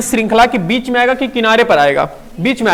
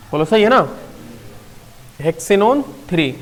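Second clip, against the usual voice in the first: ordinary talk, nothing marked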